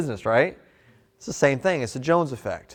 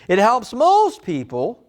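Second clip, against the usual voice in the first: higher and slow, leaning on "most"; slow emphatic line; 7 semitones higher, 4 dB louder, much slower than usual. Shown as a demonstration. Salesman hooking the customer, slow and high on "most". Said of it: "So you found something".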